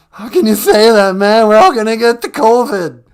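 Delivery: a scared caller losing his nerve; Cowardly Lion freaking out. Acted. Radio caller panicking about the vaccine, loud and high. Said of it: "it's a caller, Cowardly Lion".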